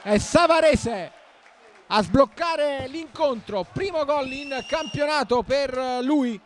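slow, high, emphatic goal call; drawn-out goal celebration. Real, not acted. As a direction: stretch every word of the goal. Goal just scored, celebrating.

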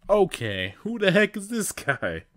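high, loud exasperated question; baffled by the stranger; confused. Acted. Sonic's confused outburst.

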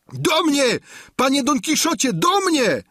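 high, loud, panicked calling out; terrified squire shouting for his master; frightened cry for help. A narrator's reading. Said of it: "zawołał". (przerażone, głośne wołanie o pomoc)

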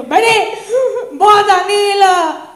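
loud shout across the bar; calling out. Acted. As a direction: yell for her friend over the noise.

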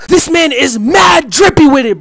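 ordinary delivery

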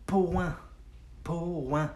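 "point" stretched into two parts, po-an; slowed down. Shown as a demonstration. Teacher breaking down the French word for learners, slow and drawn out. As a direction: sound out "point" slowly as po-an. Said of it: "Say it fast".

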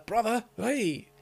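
high startled yelp; shocked. Real, not acted. Player reacting to the brother reveal, shocked. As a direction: blurt it at the twist.

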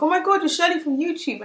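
high, gushing fan squeal; excited fan. Retold or imitated. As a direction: gush like a starstruck fan. Subscriber recognising her in shop.

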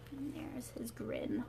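hushed aside to the listeners; whisper. Real, not acted. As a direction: whisper it like a shared secret.

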